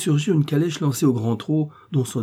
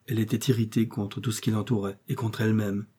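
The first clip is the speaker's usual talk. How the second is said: plain reading voice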